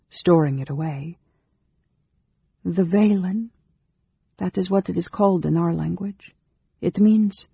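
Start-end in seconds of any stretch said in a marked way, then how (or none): 2.6-7.5 higher, careful explaining voice; higher-pitched, tentative explanation; Amberol, searching for words. A narrator's reading. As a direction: explain in a raised voice, then break off. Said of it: "she paused".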